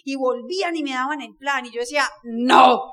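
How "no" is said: firm no. Retold or imitated. Retold flat refusal to friends.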